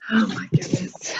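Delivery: breathless whispered aside to herself; under the breath, barely voiced; whispering. Real, not acted. Flustered aside after interruption.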